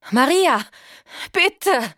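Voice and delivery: high, loud pleading call; desperate cry. A narrator's reading. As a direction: cry out her name in panic. Paige ruft verzweifelt. (verzweifelter Ruf)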